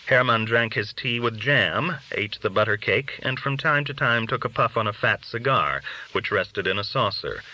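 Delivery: ordinary delivery, nothing marked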